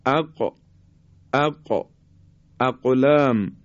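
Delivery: "اقلام" with a single ق, quickly bounced; light qalqalah. Shown as a demonstration. Teacher contrasts undoubled qaf in "اقلام", light qalqalah. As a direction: pop the ق of "اق" and "اقلام" without holding it. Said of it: "قاف رو زمانی که تشدید نداره".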